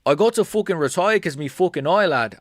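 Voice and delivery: a light Irish lilt; rough Irish lad voice, lightly done; mock Irish accent. Impression slipping into Irish while announcing retirement. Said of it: "That's, like, Irish".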